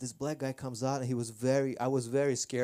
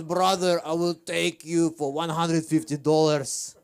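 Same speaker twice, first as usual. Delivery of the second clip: put-on mild Armenian-accented hustler pitch, a bit louder; Armenian driver; 3 semitones higher, 7 dB louder than usual. Armenian taxi driver overcharging a newcomer, mild accented sales pitch. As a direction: sell the overpriced ride in a light Armenian accent.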